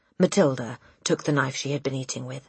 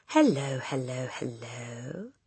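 parrot's drawn-out hello, fading softer; talking parrot; 2 semitones lower, 6 dB quieter, slower than usual. A narrator's reading. Parrot greets the waiting children, talking parrot voice. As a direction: mimic a parrot's hello, trailing off.

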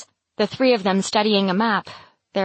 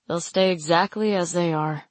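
lightly mock solemn, lower voice; mock gravity; 3 semitones lower than usual. A narrator's reading. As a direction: joke it with a grave face. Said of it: "he jests with mock gravity".

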